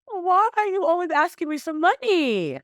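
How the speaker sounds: high, exasperated mock complaint; mock the man who always begs for money; mocking annoyed girlfriend.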